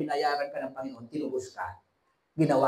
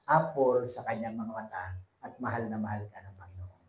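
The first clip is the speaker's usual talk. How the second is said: hushed whisper; 6 semitones lower, 8 dB quieter than usual. Real, not acted. Preacher whispers God's love to the congregation. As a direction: whisper it gently, almost no voice.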